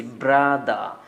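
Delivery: "ব্রাদা" keeping the r after b; British-style "ব্রাদা", no final r; first r sounded. Shown as a demonstration. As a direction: stretch "ব্রাদা", r after b, open ah ending. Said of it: "ওই আরটা বলেছি".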